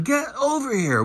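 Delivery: imitating a player barking orders, pitched high; mock yelling. Linebacker yelling at James to get over, mock yell. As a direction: snap at the teammate to get in position. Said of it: "Look at him yelling at him".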